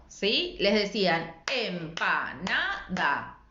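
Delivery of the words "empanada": EMPANADA in four slow separate beats, em-pa-na-da; split into syllables. Shown as a demonstration. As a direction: say EMPANADA slowly, as if clapping each syllable. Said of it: "así separaban en sílabas". (empanada, lenta y separada en sílabas, em-pa-na-da)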